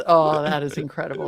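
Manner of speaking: ordinary delivery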